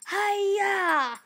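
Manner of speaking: high, loud karate yell; battle cry. Pretend. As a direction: yell as you strike. Attacking with a blow.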